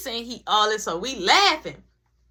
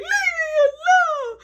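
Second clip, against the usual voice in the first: put-on high, slow, loud wailing child voice; crying kid; 6 dB louder, slower than usual. Little brother sobbing at his siblings, high wail. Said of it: "He like".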